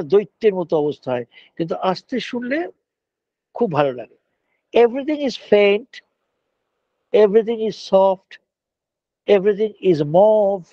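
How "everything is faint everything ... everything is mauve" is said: lingering, savoring each word; slow poetic recital. Acted. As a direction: read it like a poem to the class. Dreamy slow description. (ধীরে কবিতার মতো আবৃত্তি)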